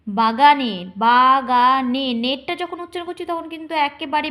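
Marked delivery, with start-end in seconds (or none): 1.0-2.1 slow motion. Shown as a demonstration. Teacher splitting the word into beats, slow-motion reading. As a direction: break it into long separate syllables. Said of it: "তখন slow মোশনে পড়বে".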